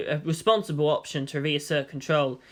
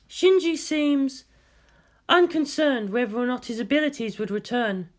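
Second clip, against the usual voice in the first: higher, lightly voiced female scientist; woman doctor voice; 7 semitones higher than usual. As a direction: lift the voice for the doctor's report.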